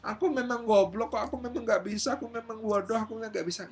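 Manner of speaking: high-pitched whiny wail; mock child beating themselves up; overdramatic self-blame. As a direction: wallow in it, melodramatically.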